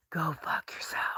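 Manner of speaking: a mocking unvoiced whisper. Shown as a demonstration. Taunting a critic in a mock whisper. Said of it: "Should I whisper to you".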